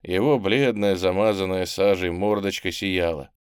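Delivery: plain reading voice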